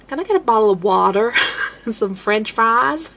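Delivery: imitating an American tourist ordering; a mock American accent, lightly done. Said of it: "my gateway into American".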